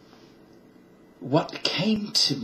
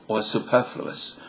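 ordinary delivery

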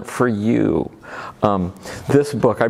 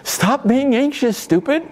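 mock scolding. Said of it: "we can't just tell our minds".

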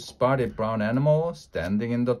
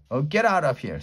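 ordinary delivery, nothing marked

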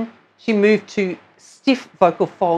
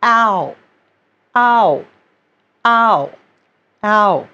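sipped ow; much slower than usual. Shown as a demonstration. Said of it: "sip the ow sound in".

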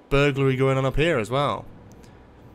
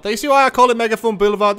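excited boast; 7 semitones higher, 5 dB louder, faster than usual. Real, not acted. Proudly showing off his road.

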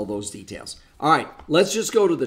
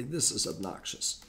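muttered complaint; 12 dB quieter than usual. Retold or imitated. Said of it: "then you're probably gonna say".

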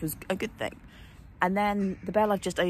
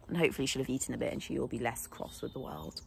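normal talking voice

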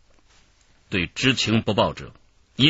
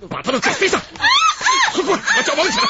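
much higher, loud barking commands; shouted orders; 6 dB louder, faster than usual. Acted. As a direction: yell at the others to tie her feet. Kidnapper orders tying her feet.

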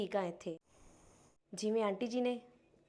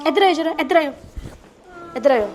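calling out; 5 semitones higher, 5 dB louder, faster than usual. Acted. Calling her over.

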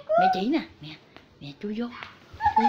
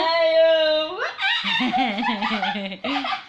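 long laugh. Real, not acted. Cười khi chơi với chó. (cười phá lên một tràng dài)

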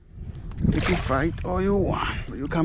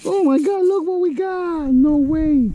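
amazed; 12 semitones higher, 4 dB louder than usual. Real, not acted. Hooks a big fish, amazed.

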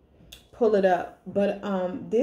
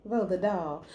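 ordinary talk, nothing marked

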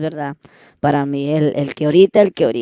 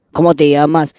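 impatient man; 9 dB louder than usual. Retold or imitated. Man asks again, louder.

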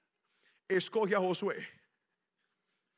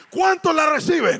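¿Cuántos la reciben? shouted louder, demanding a bigger answer; louder call; 8 semitones higher, 10 dB louder than usual. Shown as a demonstration. Predicador exige más respuesta, gritando. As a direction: push the crowd to answer louder.

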